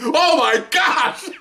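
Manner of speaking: high, slow laughing shout; laughing exclamation. Real, not acted. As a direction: cry out through real laughter. Reliving the shooting, laughing.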